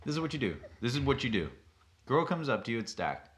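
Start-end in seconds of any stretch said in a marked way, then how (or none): none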